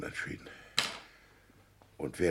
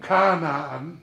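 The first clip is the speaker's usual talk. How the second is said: high, loud rapturous exclamation; loud, awestruck outburst; ecstatic cry; 10 semitones higher, 12 dB louder than usual. Acted. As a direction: cry it out like seeing paradise. Exclaims at the promised land.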